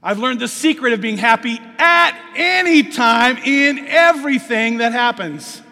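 loud, ringing sermon read; preaching at full force. Acted. Preacher proclaiming Paul's verse loudly to the church. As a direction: proclaim it to the congregation.

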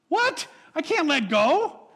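high, loud disbelieving cry; the kid can't believe it; shocked kid. Retold or imitated. Kid's shocked reply.

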